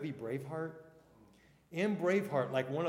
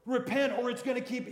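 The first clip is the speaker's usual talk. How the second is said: louder, higher, mock stern threat of judgment; mock harsh warning; 8 semitones higher, 7 dB louder than usual. Preacher mimicking a threatening message about God. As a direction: sound like a preacher scaring people. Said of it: "And not just".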